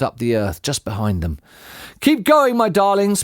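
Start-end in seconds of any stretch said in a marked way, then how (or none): 2.0-3.2 high, louder urging, mock panting; panted. A narrator's reading. Mister Fox spurs his digging family on, panting. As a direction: urge them on as if winded. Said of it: "panted Mister Fox".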